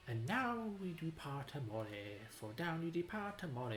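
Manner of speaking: a hint of a crooning Italian suitor; Italian accent. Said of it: "It has an Italian accent".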